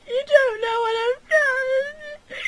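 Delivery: high, slow, loud sob; slow crying wail. Acted. Catherine sobs to Gilly about her secret, slow and high. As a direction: drag it out in tears.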